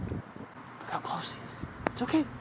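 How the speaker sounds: hushed whisper to the camera. Real, not acted. Filming the bird up close, whispering. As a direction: whisper so you don't scare him.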